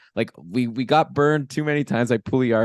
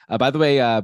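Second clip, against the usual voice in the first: ordinary delivery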